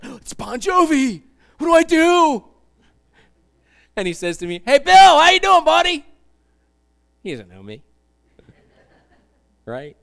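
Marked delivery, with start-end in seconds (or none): 0.0-2.4 high, loud fan freak-out; star-struck panic. Acted. Preacher acting out a fan spotting [name], star-struck panic. 4.6-6.0 very loud, high, hammed-up hello; hearty celebrity greeting. Preacher playing a star greeting him like a pal, loud and hearty.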